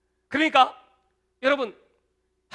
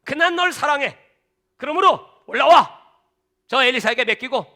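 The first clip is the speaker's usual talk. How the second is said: loud, high call from heaven; God calling Elijah up to heaven, booming; 4 semitones higher, 8 dB louder than usual. Acted.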